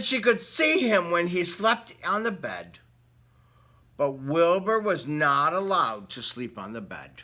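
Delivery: lively read-aloud storybook voice; story narration. Acted. Reading a picture book to children, lively storytelling voice. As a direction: read it like bedtime story time.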